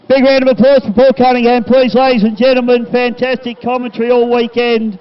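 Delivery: loud, high announcer call for applause; rousing announcer. Real, not acted. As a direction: whip up the crowd over the PA. Calls for crowd applause.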